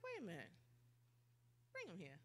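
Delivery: very soft, understated order; quiet command. Acted. Jesus stopping to call the blind man over, very quiet. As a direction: drop right down and summon him quietly.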